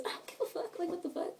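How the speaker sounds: under-the-breath whisper; whispered swearing. Real, not acted. Scene: mocking the no-friends excuse to viewers, whispered. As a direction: drop to a breathy whisper to curse.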